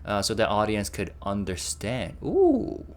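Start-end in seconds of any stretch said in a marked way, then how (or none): 2.2-2.8 impressed ooh. Real, not acted. Reacting to the subtitles.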